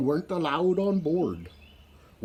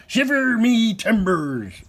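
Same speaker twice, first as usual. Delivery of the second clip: high, slow pirate voice; pirate exclamation; 8 semitones higher, 5 dB louder, slower than usual. A narrator's reading. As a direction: marvel like an old sea dog. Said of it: "the pirate said".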